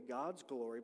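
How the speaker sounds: ordinary delivery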